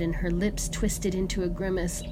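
usual speaking voice

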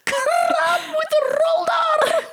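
ant voice. Pretend. Playing talking ants.

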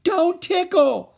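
very high, loud, slow shriek; child's shriek. A narrator's reading. Ramona shrieking at Beezus as she flees. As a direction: shriek and run. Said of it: "shrieked Ramona".

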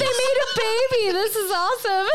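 put-on high, quick, childlike wonder; a passerby's mock joy at the billboard, high and quick; mock delighted gasp. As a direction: squeal it like a thrilled passerby. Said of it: "And I'm like".

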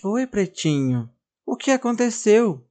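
gentle and tender. Acted. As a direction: comfort the crying doll. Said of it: "com carinho pergunta".

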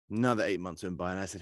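normal talking voice

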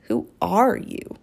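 put-on slow, breathy whisper of a wary girl; suspicious whisper. Wary girl whispering her question to the strange boy.

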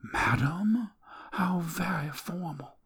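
mock his formality softly; teasing dying woman. Dying woman teases him.

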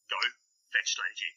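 ordinary delivery

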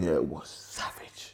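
slow, breathy whispered aside. Real, not acted. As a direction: whisper it like gossip. Gossiping about strict teacher.

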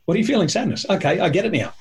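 playful mock dialogue. Shown as a demonstration. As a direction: play both sides of a quick check-in. Acting out naming emotions dialogue.

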